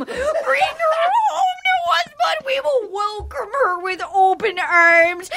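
high, slow, sobbing shout; wailing mom. Retold or imitated. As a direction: bawl the plea, hammed-up grief. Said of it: "She's like".

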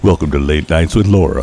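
late night DJ intro; radio host voice. Pretend. Mock radio show intro.

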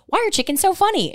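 put-on a high little-boy voice; imitating a little boy. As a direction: ask it the way a small kid would. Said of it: "He goes".

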